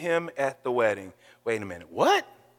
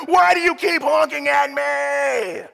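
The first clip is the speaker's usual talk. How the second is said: slow, loud, hammed-up yell; mock angry yell; 7 semitones higher, 5 dB louder, slower than usual. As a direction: bellow at the wrong driver. Imagined yell at innocent driver.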